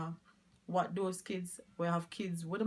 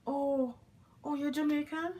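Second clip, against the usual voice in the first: imitating an eager outsider, pitched much higher; high mock surprise, imitating foreigners meeting a Jamaican; mock excited foreigner; 9 semitones higher than usual. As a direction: squeal it like a thrilled tourist. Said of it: "they're like".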